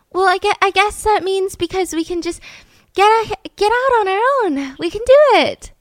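very high, hammed-up hopeful voice; plucky optimist. Optimist to his angry teammates, high hopeful mock voice. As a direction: pipe up high with naive pluck.

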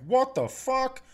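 high, shocked outburst; a stunned gasp turned into words; mock shock. Students reacting to Olivia's award, mock shocked. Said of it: "Everyone's like".

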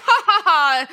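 hammed-up, high stagey cackle; vampire laugh. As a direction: fake a theatrical vampire laugh. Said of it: "he was like a vampire".

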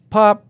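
a sudden high, loud pop; mock pop sound. Telling friends about landing the trick, a loud high pop. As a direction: snap out the pop like the board hitting the rail.

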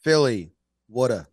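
"Wada" as Philly "wooder"; Philly accent. Shown as a demonstration. Host giving an accent lesson, Philly accent. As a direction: demonstrate Philly-accent water, short "oo". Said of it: "If you wanna do a Philly accent".

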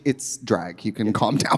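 low soft reassurance; calming. Retold or imitated. Masseuse calming a sobbing client, low and soft. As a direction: talk them down gently. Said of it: "she was like".

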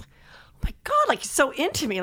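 retold swoon, starting very high and easing down; giddy gushing. Wife marvelling to herself that her husband is into her, giddy gushing.

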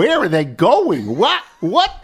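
loud, high, slow disbelief; incredulous neighbor voice; amazed gawking. Retold or imitated. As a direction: marvel at the endless caravan. Neighbors gawking at passing cars.

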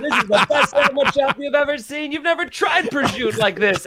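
high-pitched, over-the-top mock sales pitch; boastful hype. Comic bragging about his sandwich shop, mock boastful hype.

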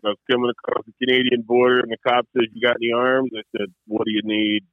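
low deadpan joke delivery; imitated low-voiced deadpan comedian; Stephen Wright. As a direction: drop the pitch and tell it deadpan. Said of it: "like the old Stephen Wright joke".

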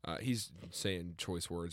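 usual speaking voice

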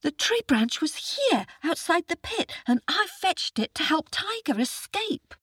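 put-on high, earnest little boy's voice; a small boy calling for help; young boy. Boy explains.

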